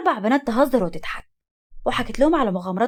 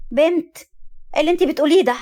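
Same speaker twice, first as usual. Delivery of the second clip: raised, louder scolding; angry; 6 semitones higher, 4 dB louder than usual. A narrator's reading. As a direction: scold the girl in angry shock, pitched up. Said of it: "نورة بغضب". (بغضب)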